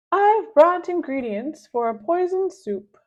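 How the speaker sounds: gleeful schemer; Mister Nuggy's voice. Acted. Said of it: "he says".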